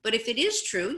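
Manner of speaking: ordinary delivery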